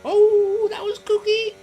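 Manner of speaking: high squeaky cartoon exclamation. Cartoonish squeal after crash.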